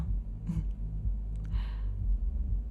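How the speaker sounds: quiet, breathy little laugh; soft chuckle. Acted. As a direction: chuckle softly to yourself. Amused aside to the customer.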